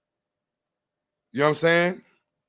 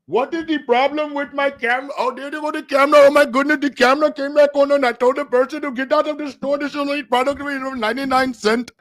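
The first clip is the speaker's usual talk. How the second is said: high-pitched mock Indian accent; fussing in an exaggerated Indian accent; put-on Indian accent; 11 semitones higher than usual. Streamer fussing about his camera to chat, mock Indian accent. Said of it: "The man is getting a little Caribbeanized".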